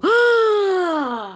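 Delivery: a big huff of breath; wolf blowing. Acted. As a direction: blow hard like the big wolf. Wolf huffing at house.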